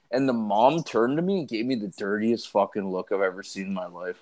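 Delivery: ordinary talk, nothing marked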